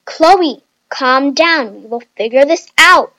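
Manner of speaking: loud, exasperated order to settle down; fed up, with emphatic force; annoyed. A narrator's reading. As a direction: snap it firmly and loudly. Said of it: "said Nikki annoyingly".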